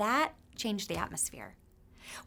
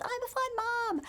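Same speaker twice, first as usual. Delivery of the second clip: much higher, a child mimicking mom's yell; mock yell. Kid teasing mom about the water slide, mock yell. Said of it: "and you yelled".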